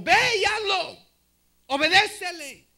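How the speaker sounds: loud, high, emphatic command; urgent exhortation. Real, not acted. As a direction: exhort the congregation to obey at once. Preacher urges congregation to obey. (exhortación fuerte y enérgica)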